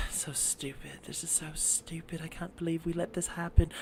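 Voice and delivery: hushed, whispery grumble; disapproving mom. Acted. Inez's mom sitting in the stands, whispered disgruntled complaint. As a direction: grumble under your breath. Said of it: "Her mom's just sitting there".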